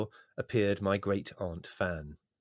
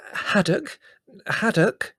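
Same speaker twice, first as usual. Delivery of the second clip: high, loud puzzled echo; bewildered; 13 semitones higher, 8 dB louder, slower than usual. A narrator's reading. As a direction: repeat the name in bewilderment.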